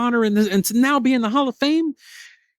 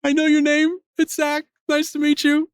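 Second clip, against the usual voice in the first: starstruck fan; 6 semitones higher than usual. Retold or imitated. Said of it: "I said".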